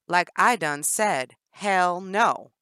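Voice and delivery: loud mock Southern twang, slow. Jennifer mocking Danny's Southern twang. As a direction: mimic her Southern drawl to mock her. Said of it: "she mocked Danny's twang".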